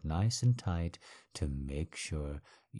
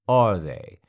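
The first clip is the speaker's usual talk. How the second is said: loud, barked question; sudden shout; 11 dB louder than usual. Acted. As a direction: snap it out loud.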